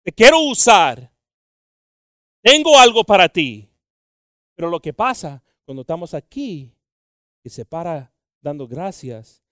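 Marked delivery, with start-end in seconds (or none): 0.0-3.7 voice of God. Acted. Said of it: "Y Dios dice".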